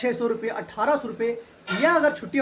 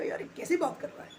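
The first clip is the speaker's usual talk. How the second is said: whispered aside; 6 semitones higher, 9 dB quieter than usual. Retold or imitated. Vendor's puzzled inner thought.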